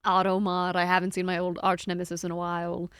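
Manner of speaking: usual speaking voice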